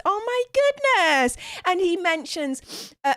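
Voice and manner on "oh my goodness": thrilled. Real, not acted. Another donation just came in.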